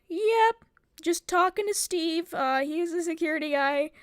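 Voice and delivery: high-pitched acted nonchalance; feigned okay. Upset student covering up to Uber driver, feigned okay. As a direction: pitch it up to pretend all is fine.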